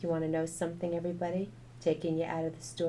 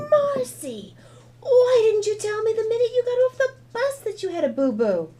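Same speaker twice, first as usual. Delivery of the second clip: high, loud angry yell at her daughter; a mother's sharp shout of scolding; mom shouting; 11 dB louder than usual. Acted. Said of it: "she stopped putting the food away and shouted".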